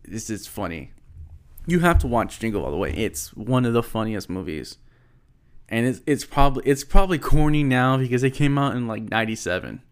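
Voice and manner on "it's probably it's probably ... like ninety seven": ordinary talk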